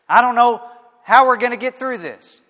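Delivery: 1 mock panic. Worried believer voiced.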